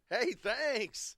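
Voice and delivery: high, loud mock thank-you; mock coach voice. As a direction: play the coach gushing thanks. Voicing the coach's thank-you text.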